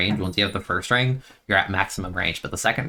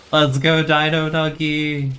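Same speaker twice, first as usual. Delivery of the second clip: higher, louder, drawn-out cheer with a laugh; excited cheer; 7 semitones higher, 4 dB louder, slower than usual. Real, not acted. Cheering on teammate Dino Nuggy mid-match, drawn-out excited cheer. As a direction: stretch out the name like a fan chant.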